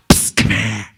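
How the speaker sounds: low, hushed sinister beckoning; sinister whisper call. Acted. Sinister whisperer behind a curtain.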